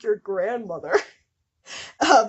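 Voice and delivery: ordinary delivery, nothing marked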